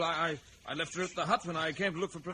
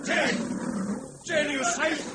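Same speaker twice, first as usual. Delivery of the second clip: high, loud anxious call; 13 semitones higher, 7 dB louder, slower than usual. Acted. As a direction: shout across to her. Calling out to Jane.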